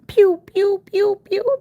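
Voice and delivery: playful laser noises for the brush strokes; mock pew sound effect. Shown as a demonstration. Showing viewers a silly sweeping sound, playful pew pew. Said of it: "I'm gonna make the sound of sweeping".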